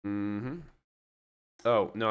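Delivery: ordinary delivery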